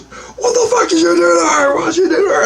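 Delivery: high-pitched, acted angry outburst; shrill-pitched mock outrage; militant confrontation. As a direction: confront him hard, imitating a furious woman. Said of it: "like she's more militant. She grabs, she goes".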